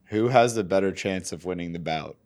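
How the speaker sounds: ordinary talk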